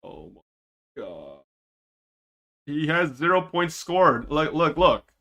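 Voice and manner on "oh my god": much lower, much softer, slow despair; muttered groan at a hopeless teammate. Real, not acted. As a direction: let it die out under your breath. Despair over the player.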